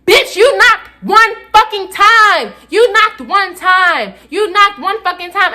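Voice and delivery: slow, high, emphatic outrage; making a scene. Retold or imitated. Student arguing over one knock, slow high indignation. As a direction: hammer each word slowly and indignantly. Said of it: "I'm putting on a whole motherfucking scene".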